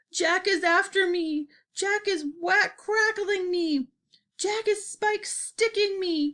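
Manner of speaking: high, drawn-out wailing; terrified bellowing. A narrator's reading. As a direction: keep up a high cry of panic, each word stretched. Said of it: "bellowed the flesh lump eater".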